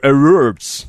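mock American accent, English words. Shown as a demonstration. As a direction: say it like an American would. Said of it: "я как американец говорю".

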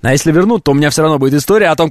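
usual speaking voice

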